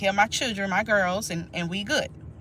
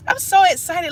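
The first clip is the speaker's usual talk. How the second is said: higher and louder, bursting with joy; excited squeal; 10 semitones higher, 6 dB louder than usual. Real, not acted. Celebrating two thousand subscribers with viewers, excited high squeal.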